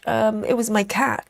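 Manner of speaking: normal talking voice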